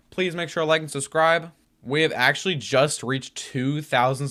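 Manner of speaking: energetic YouTuber outro; hyped outro. Real, not acted. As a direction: pump up the viewers to subscribe.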